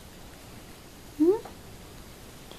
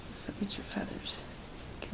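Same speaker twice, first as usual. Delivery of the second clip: whispering; 7 semitones lower, 15 dB quieter than usual. Real, not acted. Whispered to pet.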